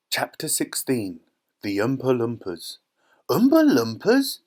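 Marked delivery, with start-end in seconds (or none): 3.3-4.4 high, loud, incredulous question; squawked disbelief from a whole crowd; astonished chorus. A narrator's reading. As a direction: echo it in amazement, pitch shooting up. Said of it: "everyone said at once".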